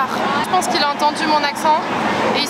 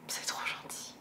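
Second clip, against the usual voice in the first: breathy whisper, almost no voice; 20 dB quieter than usual. Real, not acted. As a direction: whisper it, moved and touched. Touchée par les messages. (murmuré, touchée)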